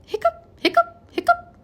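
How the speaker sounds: high squeaky hiccups; three comic high hiccups; hiccup sounds. A narrator's reading. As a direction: pop each hiccup up high. Pete's hiccups voiced in story.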